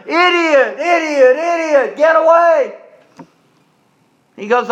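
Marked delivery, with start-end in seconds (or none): 0.1-2.7 mock heckler's holler, pitched up; retold hollering. Woman at rally heckles the man with the question, mock hollering. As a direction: holler it in repeated bursts, as a retelling. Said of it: "starts hollering".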